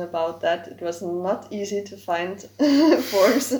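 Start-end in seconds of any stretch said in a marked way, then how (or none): 2.5-3.0 short laugh. Real, not acted. Laughing at hard discussion.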